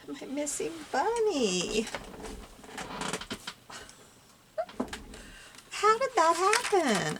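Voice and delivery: high, plaintive wail; dismayed lament. Real, not acted. Crafter to herself over her missing bunny, wailing. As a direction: stretch it out high in mock-tragic dismay.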